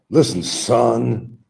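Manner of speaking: slow patronizing Southern "son"; Southern drawl. Retold or imitated. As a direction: draw it out like a Southern elder. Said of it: "they talk like that in the South".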